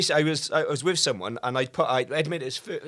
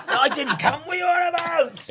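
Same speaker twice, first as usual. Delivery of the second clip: high-pitched, laughing protest; squeaky-high mock outrage through giggles; mock indignation; 4 dB louder than usual. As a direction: imitate a man defensively denying it, amused. Mimics the man's protest, laughing.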